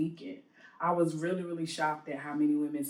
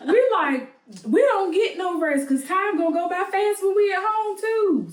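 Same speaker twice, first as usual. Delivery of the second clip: exasperated; 14 semitones higher, 7 dB louder than usual. Real, not acted. Complaining about jet lag.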